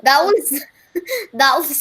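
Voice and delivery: amused, giggly false starts; real laughter breaking up the words; talking through laughter. Real, not acted. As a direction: try to speak while cracking up. Laughing at the old man voice.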